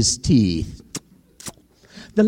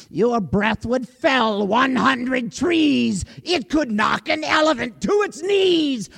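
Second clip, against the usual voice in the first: bold crocodile; 6 semitones higher than usual. Acted. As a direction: build from polite to a mocking shout. Crocodile insults the lion.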